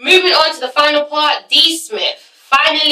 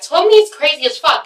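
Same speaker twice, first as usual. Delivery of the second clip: normal talking voice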